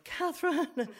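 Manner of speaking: high, sing-out name call; imitated call through a door, falling at the end; Pat calling out. Pat calls into the toilet for her, high calling voice. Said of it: "Pat opened the door and went".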